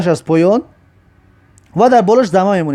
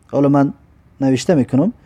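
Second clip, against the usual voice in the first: ordinary talk, nothing marked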